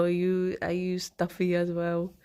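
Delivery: normal talking voice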